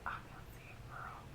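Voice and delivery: whispered aside. Real, not acted. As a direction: whisper it to a friend.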